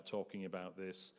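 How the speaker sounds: ordinary delivery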